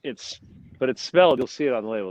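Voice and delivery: usual speaking voice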